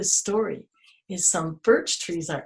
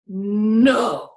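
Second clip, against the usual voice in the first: a flat, loud, curt no from a grumpy tree; grumpy tree refusing; 5 dB louder than usual. Acted. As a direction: shut the joker down with one word.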